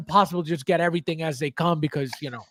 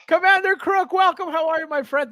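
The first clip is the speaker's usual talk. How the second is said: hyped greeting; 8 semitones higher than usual. Real, not acted. Hyped welcome to a chat viewer.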